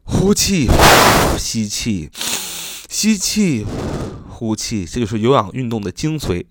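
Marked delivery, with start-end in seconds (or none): 0.0-4.9 teacher acting out breathing out and in; breathing demo. Shown as a demonstration. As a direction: breathe out audibly on 呼气, then breathe in on 吸气. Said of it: "吸收空气".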